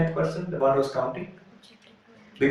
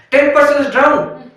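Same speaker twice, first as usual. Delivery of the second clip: high, slow wail of dismay; mock lament; 11 semitones higher, 6 dB louder, slower than usual. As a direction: cry it out as if the friend is lost. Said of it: "And they started crying".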